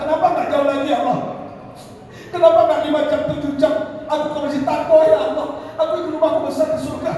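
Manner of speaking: high, pleading cry of regret; regretful lament. Retold or imitated. Dying Sauban regrets his small house in heaven, regretful plea. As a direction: lament it high, as if too late. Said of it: "Sauban menyesal".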